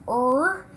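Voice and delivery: "R-A-D-R" as four separate sounds: er, ay, d, ar; tapped out by sounds. Shown as a demonstration. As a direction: sound out "R-A-D-R" one phoneme at a time, long pauses between. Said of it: "Let's tap that".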